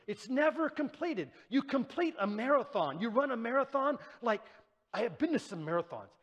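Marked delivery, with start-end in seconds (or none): none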